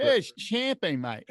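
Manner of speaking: mock Australian bloke correcting you; Australian accent. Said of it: "why did the English guy have an Australian accent".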